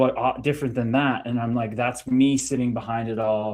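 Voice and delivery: ordinary delivery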